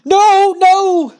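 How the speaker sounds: a wrestler snapping, yelling no; frantic no. Retold or imitated. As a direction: shriek no like he's losing his mind. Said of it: "he starts saying".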